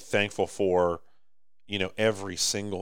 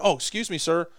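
higher, overly polite voice, building louder to the last words; mock polite stranger; 9 semitones higher, 3 dB louder than usual. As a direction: play a courteous shopper stepping aside, a bit hammed-up. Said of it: "it'd be better if you stopped and went".

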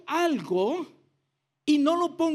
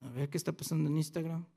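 low, soft, quick aside; distracted self-talk; 9 semitones lower, 10 dB quieter, faster than usual. Churchgoer checking the phone during the sermon, distracted murmur. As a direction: murmur it idly to yourself, attention drifting. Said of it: "de repente digo".

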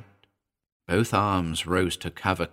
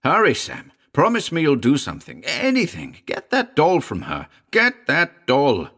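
desperate plea; 8 semitones higher, 6 dB louder than usual. Acted. Dying man pleads urgently.

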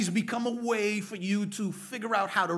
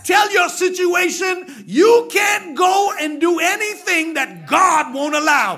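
much louder and higher, shouted preaching; preaching at full force; 10 semitones higher, 13 dB louder than usual. Acted. Preacher shouting to the congregation at full force. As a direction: build the church to its feet, full voice.

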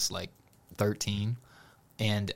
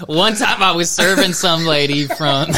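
loud, high, words through laughter; laughing; 8 semitones higher, 8 dB louder than usual. Real, not acted. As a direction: try to explain while cracking up. Laughing at own forgetfulness.

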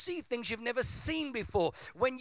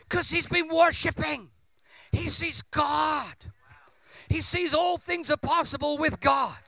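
preaching at full force; 8 semitones higher, 7 dB louder than usual. Real, not acted. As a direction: build to a fiery preacher's peak. Preaching climax.

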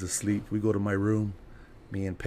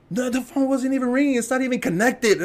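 high-pitched, incredulous correction; exasperated dad; 12 semitones higher than usual. Retold or imitated. Dad corrects his high son about phone, exasperated. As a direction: imitate a father losing patience, pitched way up.

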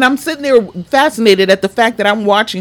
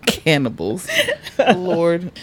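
normal talking voice

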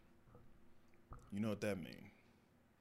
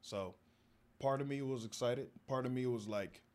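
usual speaking voice

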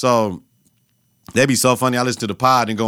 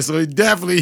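normal talking voice

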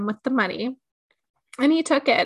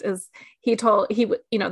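normal talking voice

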